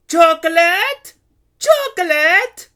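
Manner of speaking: high, drawn-out vendor's cry; booming. A narrator's reading. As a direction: boom it out slowly from a market stall. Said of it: "Carlotta boomed from the stall".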